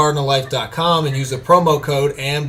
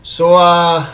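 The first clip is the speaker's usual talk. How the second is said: hammed-up Cuban gangster accent, pitched up; Tony Montana accent. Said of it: "Dave suggested Tony Montana".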